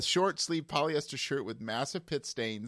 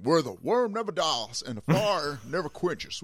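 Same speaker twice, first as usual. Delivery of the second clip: put-on Texas accent; 4 semitones higher than usual. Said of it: "the accent's there deliberately".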